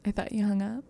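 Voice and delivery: very soft creep on the phone; creepy caller. Retold or imitated. Imitating the caller after the hang-up, creepy and very soft. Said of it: "he sounds so creepy".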